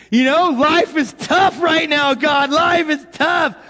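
high, drawn-out wail to God; mock pained complaint. Acts out complaining to God in pain.

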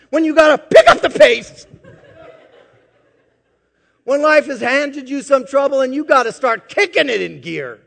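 preacher pressing the scripture loud and high; full-force preaching. Acted. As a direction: drive the verse home to the crowd.

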